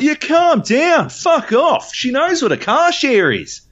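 high, drawn-out mock shouting; imitated angry yell. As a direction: bellow it high, imitating an aggressive bloke. Acted man yelling in a quarrel.

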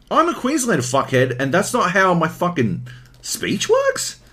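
high indignant upswings on "Queenslander" and "works"; exaggerated high questioning tune through to "works"; mock Queenslander upspeak. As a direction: protest with "works" lifted like a question. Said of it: "sound like a Queenslander where your voice inflects up".

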